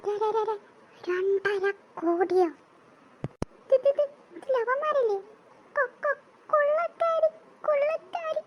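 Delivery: high, slow, stuttering fright; terrified stammer. Acted. Spying villager stammering at the robbers, terrified. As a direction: stammer in panic.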